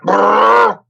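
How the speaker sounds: loud high mock engine rev; loud revving engine noise with the lips. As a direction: rev it like a car speeding off. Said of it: "It was like".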